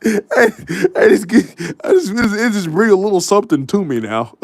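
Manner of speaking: high, tearful imitation breaking into laughter; laughing mock sob in the words; mock choked up. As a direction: fake being choked up while cracking up. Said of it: "every time you get choked up".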